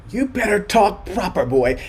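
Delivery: stern mother. Retold or imitated. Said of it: "she'd say".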